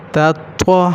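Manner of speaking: تا thin, then توا full and heavy; light then heavy t. Shown as a demonstration. Teacher contrasting two letters, light تا then heavy توا. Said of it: "ایک تا ہے".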